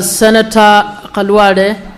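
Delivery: ordinary talk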